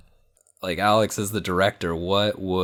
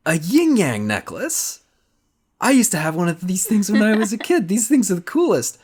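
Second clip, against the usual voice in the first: put-on much higher, louder, giddy childish voice, rushing the later lines; excited kid gushing; 12 semitones higher, 5 dB louder than usual. [name] thrilled at finding a yin-yang necklace, giddy high voice. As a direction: gush like a kid who just found treasure.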